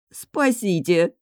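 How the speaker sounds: drawn-out, squeaky cry for help; squeaked plea. A narrator's reading. Влада begs for rescue from her friends, squeaking. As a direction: squeak it out slowly, mock-helpless. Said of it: "пискнула Влада".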